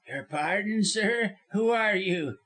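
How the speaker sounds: high, suspicious snarl; mistrustful lawyer's retort; snarling demand. A narrator's reading. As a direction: challenge the stranger with a light snarl. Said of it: "with a kind of snarl in his voice".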